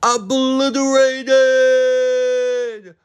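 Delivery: long, high, loud drawn-out shriek; shrieked outrage. Real, not acted. Recapping a show, shrieking in mock horror. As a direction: stretch it out in a high wail. Reacting to getting rocked.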